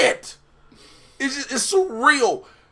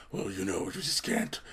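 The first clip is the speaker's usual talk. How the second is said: unvoiced whisper of the swamp creature; whispering Swamp Thing; 17 dB quieter than usual. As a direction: breathe the words like a tired swamp monster. Swamp Thing voiced in recap.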